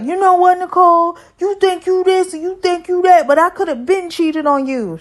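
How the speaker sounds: high, loud, heated outburst; angry. Retold or imitated. Husband ranting at Nicole, high and loud. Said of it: "And he's like".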